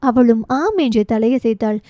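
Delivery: plain reading voice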